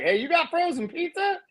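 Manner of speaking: high, gleeful put-on excitement; eager mock request. Retelling visits to girls who called in, high mock eagerness. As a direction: pitch it way up, playfully thrilled. Imitates his younger self asking girls.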